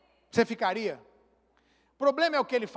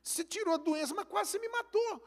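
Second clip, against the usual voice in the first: hurt member's protest; 6 semitones higher, 5 dB quieter than usual. Retold or imitated. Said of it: "Ele falou".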